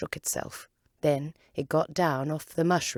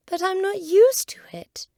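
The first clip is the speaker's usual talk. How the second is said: much higher and louder, pleading; high, pitiful pleading; piteous plea; 10 dB louder than usual. Acted. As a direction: beg pitifully like a small girl. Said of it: "pleaded poor Alice in a piteous tone".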